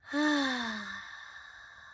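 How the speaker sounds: long slow exhale through the mouth; demo breath out. Shown as a demonstration. Showing kids how to breathe out for relaxing, slow exhale. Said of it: "Harry, you show them".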